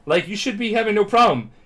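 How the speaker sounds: high-pitched exasperated questioning; acted exasperated disbelief; incredulous scolding. Acts mentor scolding collapsed trainee.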